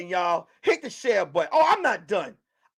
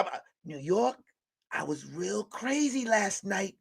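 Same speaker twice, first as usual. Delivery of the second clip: ordinary talk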